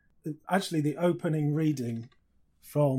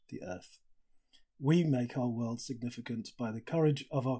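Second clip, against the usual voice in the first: hushed, awed reading of a passage; reading aloud; 3 semitones lower, 4 dB quieter than usual. Shown as a demonstration. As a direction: let the words settle, quiet and moved. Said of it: "just wanted to read to you".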